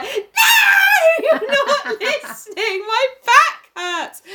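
put-on high, loud whining child; whiny child. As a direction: wail it like a hurt kid. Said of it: "you were like".

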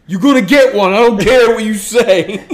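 loud, high bro voice, laughing; pushy frat guy shouting. Retold or imitated. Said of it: "dude in cargo shorts and a backwards hat".

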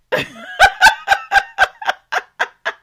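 high, loud burst of laughter; real laughter breaking out; big laugh. Real, not acted. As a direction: crack up at yourself. Laughing at own rhyme.

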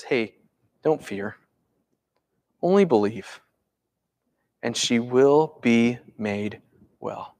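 low, soft, measured comfort; calming reassurance. Acted. Jesus reassuring Jairus about his daughter, calming. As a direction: settle a frightened parent gently. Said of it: "Jesus is calming him".

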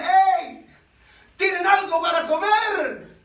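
loud friendly shout to the fishermen; calling out. Acted. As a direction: hail the boat across the water. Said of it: "les grita y le dice".

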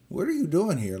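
high, incredulous teacher; puzzled teacher. Retold or imitated. Teacher questioning a good student, high incredulous tone. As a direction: ask it like a baffled teacher.

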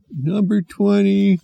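high, slow called-out number; clerk announcing. Acted. Clerk calling the next number, slow announcement.

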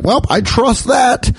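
high, slow mock acceptance; mock trusting. Comedian pretends to trust the shrimp test, high and mock-convinced. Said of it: "I'm just gonna be like".

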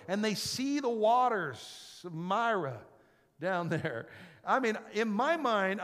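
loud, high storytelling preacher; loud sermon storytelling; fired-up preaching. Real, not acted. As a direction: preach it out big. Preaching at full force.

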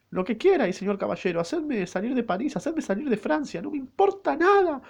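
put-on desperate falsetto girl begging her knight; pleading maid voice.

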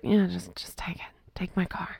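very soft, half-whispered grumble; imitated drowsy, barely voiced reply; sleepy mumble. Voices herself half asleep in bed.

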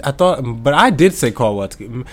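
ordinary talk, nothing marked

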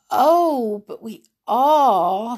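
high, slow yawn through the words. A narrator's reading. Sleepy answers the doubtful guard, yawning. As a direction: stretch the words into an acted yawn. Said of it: "Sleepy yawned".

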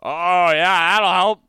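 high, loud mock enthusiasm; exaggerated sarcastic agreement; sarcastic oh yeah. Real, not acted. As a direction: sneer it with fake cheer. Sarcastic reply to agenda advice.

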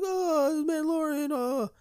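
very high, loud fangirl squeal; overexcited fan. Retold or imitated. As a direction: squeal it, imitating a fan losing it. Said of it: "you're, like, Endgame level excited".

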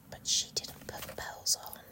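very soft whisper; hushed, voiceless aside. Pretend. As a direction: whisper it as a secret objection. Joins the hush with an aside.

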